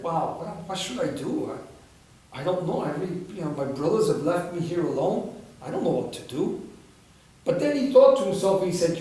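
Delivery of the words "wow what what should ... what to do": lost and unsure. Acted. Prince alone and lost.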